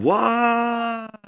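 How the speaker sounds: high hollered answer; hollering back. Retold or imitated. Boy calling back across the pasture, high holler. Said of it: "I hollered across the pasture back".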